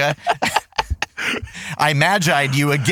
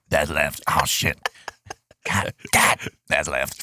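low deep voice; 9 semitones lower, 4 dB quieter than usual. Acted. Deliberately wrong line in character.

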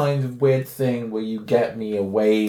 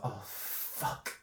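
breathy whispered swear; whispered curse; 18 dB quieter than usual. Retold or imitated. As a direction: mutter it under your breath. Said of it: "And she's like".

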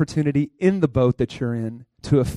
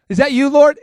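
high eager call; 9 semitones higher, 7 dB louder than usual. Retold or imitated. Peter calls out, unsure.

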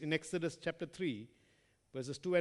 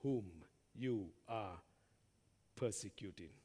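voice of Jesus; 7 semitones lower, 6 dB quieter than usual. Acted. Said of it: "And he said".

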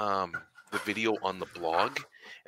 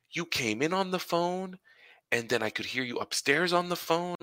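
imitating a wife, pitched well up; higher voice for a puzzled wife's account; wife's remark; 9 semitones higher than usual. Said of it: "she goes".

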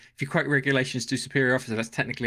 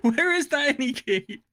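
baffled reading; 10 semitones higher, slower than usual. Retold or imitated. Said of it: "it says".

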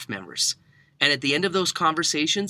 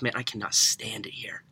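whispering, glancing round for the boss; a retold employee confessing in a whisper; a secret shared under the breath; hushed whisper; 3 semitones lower, 6 dB quieter than usual. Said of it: "the kid got quiet".

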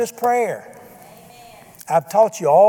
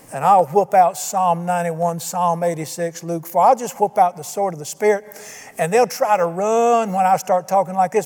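ordinary talk

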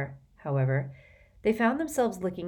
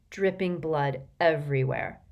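ordinary delivery, nothing marked

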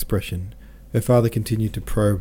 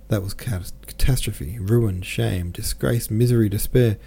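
usual speaking voice

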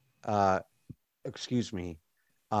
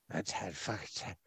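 very soft, low mumble; sleep talking; 5 semitones lower, 17 dB quieter than usual. Acted. Sleeping woman at the table cursing Ted, barely audible. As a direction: grumble it in your sleep. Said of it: "the woman that's sleeping on the table".

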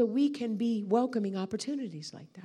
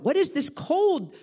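amazed cry, higher and louder; imitated startled outburst; retold yell; 5 semitones higher, 5 dB louder than usual. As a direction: yell it out like a man astonished. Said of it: "he yelled out".

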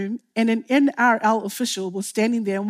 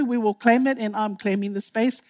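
ordinary talk

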